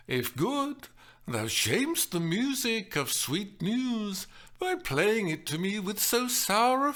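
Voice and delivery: high, sweetly scolding recital; good-natured reprimand. Acted. Juliet playfully scolding the nurse for her sour face. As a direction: chide the servant gently, all sugar. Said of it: "in good-natured reprimand".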